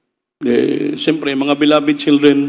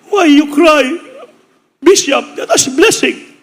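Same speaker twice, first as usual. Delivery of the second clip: much higher, slow and louder, gently asking; high comforting voice of the American visitor; American consoling; 11 semitones higher, 4 dB louder, slower than usual. Retold or imitated. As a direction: coax him out of his tears, high and slow. American comforts the weeping pastor.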